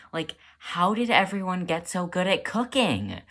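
high-pitched bewildered complaint; exasperated disbelief. Acted. Struggling student watching classmates cope, exasperated. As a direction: wail the question in frustration.

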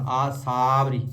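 ਆਸਾਵਰੀ with saa joined to vri; aa-saavri. Shown as a demonstration. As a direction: keep saavri together in ਆਸਾਵਰੀ. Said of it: "ਆਸਾ ਅਲੱਗ ਨਹੀਂ ਕਰਨਾ".

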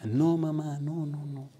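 high voice sinking away, imitated crying son; tearful. Crying son on the phone to his mother, tearful. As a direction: act out a tearful denial. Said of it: "Llama a la mamá llorando".

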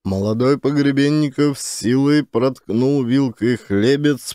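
ordinary talk

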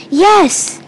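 high, loud burst of agreement; excited yell. A narrator's reading. Two friends agree to follow the man, eager yell. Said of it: "they both yelled".

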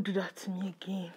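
breathy whisper after a scare. Real, not acted. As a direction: whisper it, still shaken.

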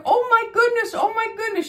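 high, distressed exclaiming; imitated upset woman. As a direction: mimic an elderly woman fretting in a high voice. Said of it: "She was all upset over it".

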